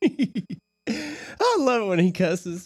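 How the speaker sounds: laughing through the words; giggly, slow laughing delivery. Real, not acted. Laughing while talking.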